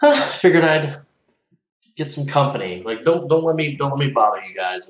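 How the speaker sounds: a laid-back traveller brushing off the fuss; casual character voice. Acted. Player voices his relaxed character.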